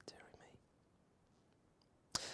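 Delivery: whispered dear me after a sigh. Real, not acted. Narrator despairing at the editor, whispering. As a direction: sigh it out under your breath.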